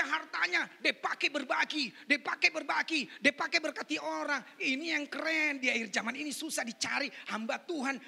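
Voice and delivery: full-force pulpit preaching; loud, emphatic sermon delivery. Real, not acted. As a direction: proclaim it loudly to the whole congregation.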